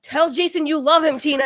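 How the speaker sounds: loud, insistent snapped order; mock yell, hard and forceful; retold snap. Friend snaps at her to confess, retold loud snap. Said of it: "so he snapped at me again".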